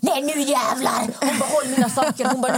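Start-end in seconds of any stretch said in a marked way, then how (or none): 0.0-1.0 raised-pitch mock war cry; imitated fury. Angry friend charging back at the couple, imitated fury. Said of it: "Hon blev så arg". 1.5-2.2 higher-pitched mock anger; imitated fury. Angry friend handing over her things, imitated fury. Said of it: "Hon blev så arg".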